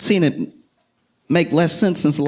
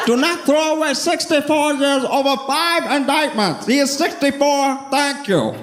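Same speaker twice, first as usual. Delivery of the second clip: high, imitated podium plea with a sign-off; mock slick supporter; 13 semitones higher than usual. Supporter closing his defense of the mayor, high mock oratory. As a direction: declaim it high, like a speaker resting his case. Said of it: "this dude was slick with it".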